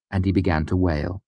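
usual speaking voice

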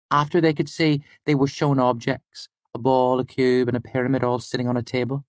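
high-pitched student storyteller; Fela. Acted. Student telling the lecturer a medical discovery, high-pitched. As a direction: explain the fact in a high, even voice. Student tells her fact.

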